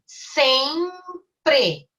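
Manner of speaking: "Sempre" in two drawn-out, separated beats; teacher sounding out "Sempre" syllable by syllable. Shown as a demonstration. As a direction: break "Sempre" into sem, pre with a gap between. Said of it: "Eu abro duas vezes a minha boca".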